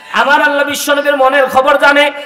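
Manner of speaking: high, loud melodic proclamation; full-force preaching. Acted. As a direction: raise the voice and proclaim it to the congregation. Returns to high waz delivery. (উঁচু সুরে জোরালো ওয়াজ)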